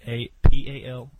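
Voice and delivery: loud bursts on each P; letters spoken with hard, loud popping P's; popping p sounds. Real, not acted. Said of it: "my P's are popping".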